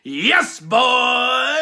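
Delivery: a hint of a cocky Blackpool lad; lightly voiced swaggering wrestler's catchphrase; Pretty Deadly impersonation. Host briefly imitating the champions with a light cocky voice. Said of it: "That's my Pretty Deadly impersonation".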